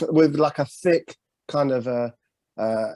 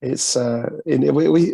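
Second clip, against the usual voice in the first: ordinary talk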